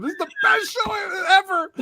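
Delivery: very high, strained excited shout; re-enacted yell. Dad re-enacting how he yelled at his kids, high mock-frantic yell. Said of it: "but I yelled at them".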